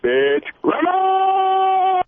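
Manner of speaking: high, fast squealing insult; shrieking prank voice. Acted. Prank caller shrieking insults at the radio host.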